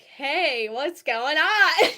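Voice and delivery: loud, slow mock parent greeting; imitating a parent checking in. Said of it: "like, be like".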